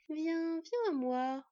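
high, drawn-out beckoning that glides down; pleasant coaxing. A narrator's reading. Hull luring little Scad to come, pleasant coaxing. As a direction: coax the child closer, singsong. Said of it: "enjoignit plaisamment Hull à Scad".

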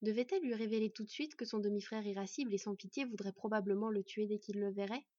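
plain reading voice

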